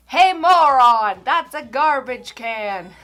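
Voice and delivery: imitating a slow, drawn-out yell; retold yell. Driver behind yells out his window, imitated drawn-out holler. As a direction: yell it like a heckler, stretching each word. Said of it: "and he yells".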